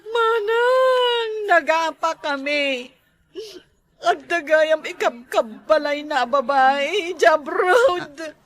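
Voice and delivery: put-on sobbing confession to an older sister; crying. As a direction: cry it out, breaking into wails. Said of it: "apay nga rod nga agsangit-sangit ka". (agsangsangit nga agipulpulong)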